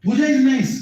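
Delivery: high, delighted praise. Retold or imitated. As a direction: blurt out the praise, high and a bit loud. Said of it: "Nanda Maharaj is like".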